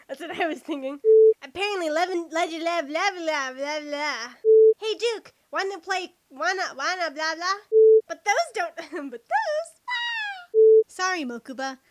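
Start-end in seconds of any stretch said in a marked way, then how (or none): none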